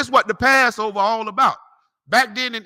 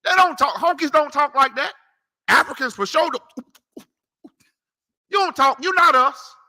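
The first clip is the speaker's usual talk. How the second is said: high-pitched, insistent protest; worked-up preacher's rebuttal; emphatic denial; 7 semitones higher, 4 dB louder than usual. Real, not acted. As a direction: push each denial up high and louder. Preacher disowning others' speech, worked up.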